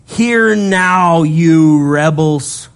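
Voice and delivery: a stern, drawn-out reproach; angry rebuke. Acted. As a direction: berate them as rebels, slowly. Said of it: "And he said to them".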